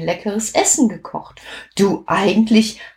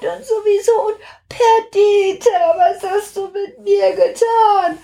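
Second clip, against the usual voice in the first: excited. Acted. Belly swelling, high excited narration.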